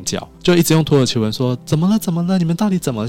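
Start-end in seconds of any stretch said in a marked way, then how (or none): 1.7-3.1 high-pitched mock screaming of a frantic wife; imitated panicked shrieking. Turkish friend's wife keeps asking what happened, mock shrieking. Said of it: "太太一直在尖叫".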